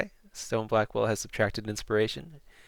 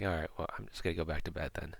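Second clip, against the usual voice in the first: low, quick, soft muttering; quiet, hurried dismissal; resigned mumble; 5 semitones lower, 9 dB quieter, faster than usual. Acted. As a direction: mumble it to yourself, giving up. Prisoner gives up, returns to bed.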